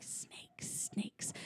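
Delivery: voiceless whispered mimicry; hushed whisper. Podcaster acts out burglar overhearing the film, hushed whisper. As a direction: whisper it, barely audible. Acts out Marv hearing movie gunfire.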